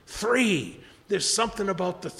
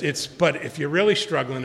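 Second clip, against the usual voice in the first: normal talking voice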